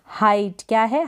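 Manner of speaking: ordinary talk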